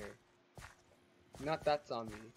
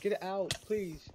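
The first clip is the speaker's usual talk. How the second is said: high, loud get out; exasperated shout at a mob; shooing yell; 7 semitones higher, 6 dB louder, slower than usual. Real, not acted. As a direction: chase the mob off.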